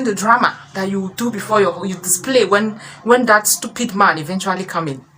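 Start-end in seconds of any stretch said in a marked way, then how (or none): none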